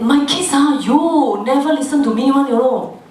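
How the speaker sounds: hammed-up grumbling; mock complaining. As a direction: whine in acted exasperation about the children. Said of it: "Then we go and complain".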